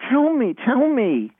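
put-on high, drawn-out kid's plea; begging child. Child self begs, retold.

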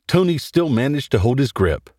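ordinary delivery

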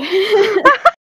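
loud, high burst of laughter; real tired, giggly outburst. Real, not acted. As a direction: crack up loudly. Tired after flubbing the line.

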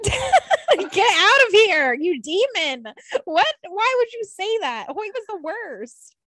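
loud, high-pitched scandalized outburst; mock outrage. Co-host scolding friend for missing a hated character, mock outrage. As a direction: recoil in playful horror, voice shooting up.

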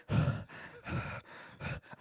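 heavy breaths after running; acted-out winded gasps; out-of-breath panting. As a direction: pant as if just stopped sprinting. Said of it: "And he's like".

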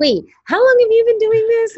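high, loud astonished question; shocked client. Retold or imitated. New client hearing she has worked twenty years, astonished. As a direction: blurt it out in disbelief.

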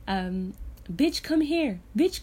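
ordinary delivery

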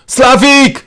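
high, loud shout for help. A narrator's reading. Patient shouts toward the corridor for the carer, shouting. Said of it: "אני צועק לעבר המסדרון".

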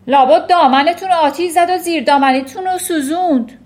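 loud, high mocking guess; snorting scorn. A narrator's reading. Headmistress snorting at the teacher's news, scornful. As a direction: throw it out loudly with scorn. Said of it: "خورناس کشید".

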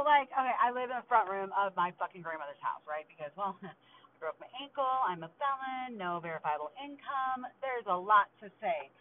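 usual speaking voice

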